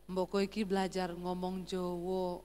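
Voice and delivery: low, soft Javanese request; soft-spoken Solo husband. Retold or imitated. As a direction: ask gently in a low voice. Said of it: "Orang Solo selalu rendah". (suami Solo meminta dengan pelan)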